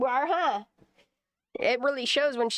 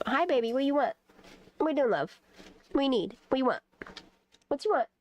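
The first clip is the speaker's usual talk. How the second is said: high sweet voice for the cat; 6 semitones higher than usual. Real, not acted. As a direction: coo at the cat like a pet. Talking to her cat.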